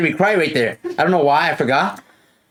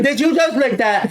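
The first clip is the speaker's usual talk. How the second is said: a lighter high mock of the teacher's question; mock teacher quote; 7 semitones higher than usual. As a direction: toss the accusation back in a smaller sneering voice.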